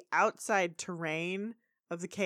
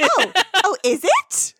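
high, loud, eager squeal; oblivious delight; 12 semitones higher, 12 dB louder than usual. Retold or imitated. Pony missing granny's sarcasm, loudly eager. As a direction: ask it brightly as if it were a real question. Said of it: "she does not get the sarcasm at all".

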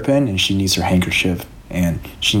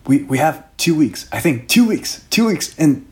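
higher, breathless blurting with a laugh; panicking princess, higher and giggly; frantic [name]; 7 semitones higher than usual. Acted. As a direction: babble the doom out in a rush. [name] blurting about the end.

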